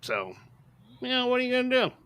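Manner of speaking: mock dismissive people. Reviewer mimicking people who excuse chick flicks, mock dismissive. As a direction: imitate people brushing it off. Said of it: "people say".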